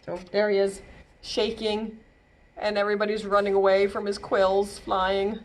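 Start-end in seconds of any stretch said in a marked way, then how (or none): none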